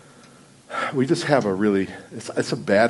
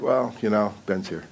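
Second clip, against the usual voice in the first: softer, half-hearted mock introduction; unenthusiastic; 2 semitones lower, 6 dB quieter than usual. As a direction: mumble a lackluster intro, imitating a failed take. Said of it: "the enthusiastic introduction of me".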